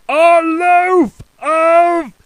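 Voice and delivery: very slow loud high shout; top of his voice. Acted. Mister Noisy shouting at the baker, then stopping. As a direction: bellow it and cut off. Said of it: "shouting at the top of his voice".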